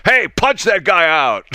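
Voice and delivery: brash, loud rally-boss voice; Trump impression. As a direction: bark it like a rowdy rally speaker. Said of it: "and Trump says".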